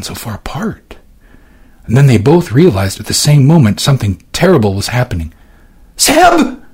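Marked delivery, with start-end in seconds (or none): 6.0-6.6 high piercing scream of her brother's name. A narrator's reading. Sister screaming for her brother as he falls, high scream. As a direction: shriek his name in panic. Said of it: "she screamed".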